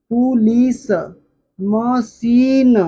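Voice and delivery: Machine stretched very slowly, each sound held; slow phonics demo. Shown as a demonstration. Teacher sounding out machine for the class, very slowly. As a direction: draw the word out for learners. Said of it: "बड़ी की मात्रा".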